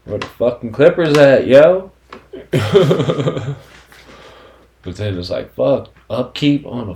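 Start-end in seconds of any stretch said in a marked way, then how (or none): 0.0-1.9 mock frantic yell. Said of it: "you're like". 2.1-3.6 laughing. Real, not acted. Laughs at own joke.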